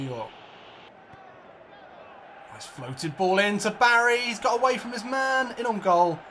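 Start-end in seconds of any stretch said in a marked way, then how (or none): 2.5-6.2 excited play-by-play calling the attack; live commentary. Acted. Gamer calling his own attack, excited commentary. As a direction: call it like a match commentator.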